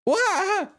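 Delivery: high loud startled yelp. Real, not acted. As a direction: jump at a sudden scare. Startled cry at game event.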